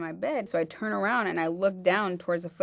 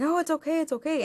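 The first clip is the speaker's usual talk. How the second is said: much higher, singsong brush-off; mock high-pitched drunk reassurance; drunk guy placating; 10 semitones higher than usual. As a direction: coo it like a drunk trying to calm her. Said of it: "And he's like".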